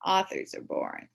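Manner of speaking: plain reading voice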